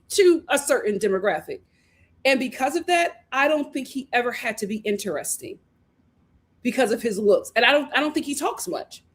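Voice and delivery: usual speaking voice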